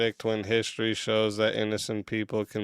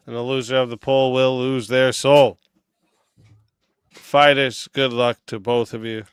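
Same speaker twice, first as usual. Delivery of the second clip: hype announcer; 9 dB louder than usual. Acted. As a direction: pump up the chat like a ring announcer. Host hypes the debate rules.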